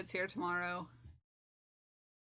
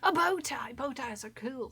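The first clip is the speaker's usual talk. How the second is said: put-on British accent; 3 semitones higher than usual. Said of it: "slipped into a British accent, and then I turned into the doctor".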